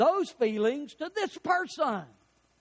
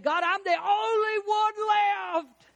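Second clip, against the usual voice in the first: much higher, loud, slow despairing cry; Elijah's lament; 10 semitones higher, 4 dB louder, slower than usual. Retold or imitated. Elijah crying to God that he is alone, high loud wail. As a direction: wail it as the last man standing. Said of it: "He said".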